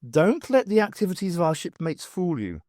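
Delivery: ordinary talk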